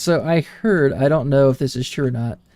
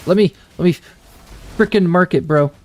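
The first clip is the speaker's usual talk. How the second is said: raging gamer; 5 semitones higher than usual. Real, not acted. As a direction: snap at the game in frustration. Frustrated gamer fumbling the mark.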